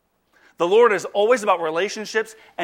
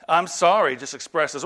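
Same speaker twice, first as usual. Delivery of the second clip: usual speaking voice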